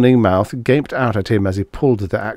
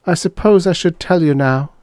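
high, louder, halting, choked words; 10 semitones higher, 4 dB louder than usual. Acted. Dana choking out her confession to Harvey. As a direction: force it out past a lump in the throat. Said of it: "she choked out".

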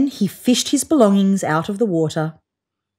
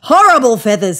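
louder, firm and final; 3 semitones higher, 8 dB louder than usual. A narrator's reading. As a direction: shut the hope down firmly. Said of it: "said the wallaby firmly".

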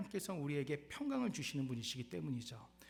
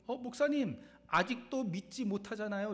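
mock congregant; 7 semitones higher, 5 dB louder than usual. Pastor mimics congregant objecting.

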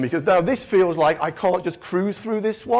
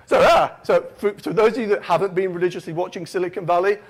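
ordinary talk, nothing marked

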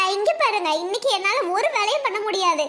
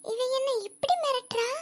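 quiet aside; 9 dB quieter than usual. Acted. Aside to self, stage whisper.

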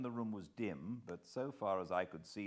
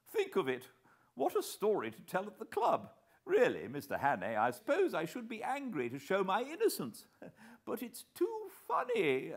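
higher, louder, laughing bluster; amused plump man; 13 semitones higher, 5 dB louder than usual. A narrator's reading. Plump man jokes with Hannay, amused and higher. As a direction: treat the arrest as a club anecdote.

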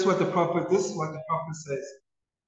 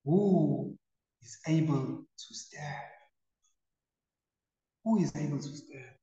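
hushed plea; 5 dB quieter than usual. Retold or imitated. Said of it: "the hundred forty-four cry out".

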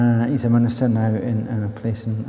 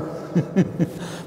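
short, real chuckle; amused chuckle; 5 semitones higher, 5 dB quieter than usual. Real, not acted. Preacher after joking about the gas board, chuckling. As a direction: chuckle at your own aside. Laughs at own aside.

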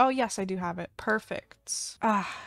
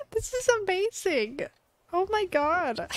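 delighted squeal; 7 semitones higher than usual. Real, not acted. Delighted by fan art.

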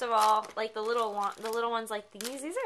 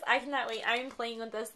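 normal talking voice

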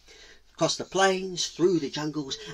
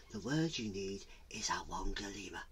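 low, breath-soft secret; hushed, low mystical reveal; whispering spirit; 5 semitones lower, 13 dB quieter than usual. Acted. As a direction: whisper the magic word. Mountain spirits whisper the word.

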